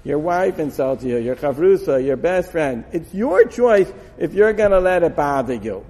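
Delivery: ordinary talk, nothing marked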